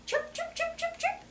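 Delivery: tiny, very high, soft cheeps; chick chirping. Retold or imitated. Voices chicks in picture book.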